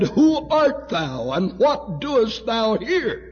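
high, loud voice from the throne; booming divine demand. Retold or imitated. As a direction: proclaim it like a heavenly challenge.

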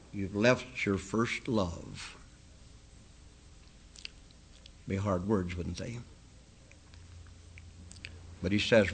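low, softened pulpit voice; hushed rebuke. Acted. Preacher quoting Christ's rebuke to the Ephesus church, hushed and low. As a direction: drop low and let the hard words sink in.

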